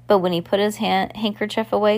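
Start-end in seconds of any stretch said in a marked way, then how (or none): none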